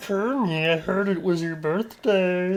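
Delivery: high, drawn-out puppet voice; Kermit impression. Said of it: "Is that a decent Kermit?".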